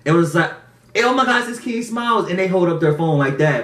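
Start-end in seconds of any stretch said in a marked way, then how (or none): 0.9-2.3 excited fan. Retold or imitated. Fan calling from SUV.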